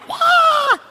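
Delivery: short high-pitched mock scream; retold scream. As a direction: let out an imitated shriek, way up high. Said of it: "I'm screaming like".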